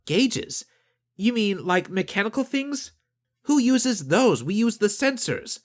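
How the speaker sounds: put-on incredulous young woman. Nadine voiced, incredulous.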